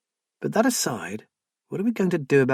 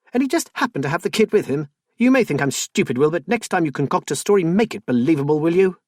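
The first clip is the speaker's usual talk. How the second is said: louder, high and fast, scornful; angry accusation; 6 semitones higher, 5 dB louder, faster than usual. Acted. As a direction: tear into his excuse. Wife accusing husband of lying.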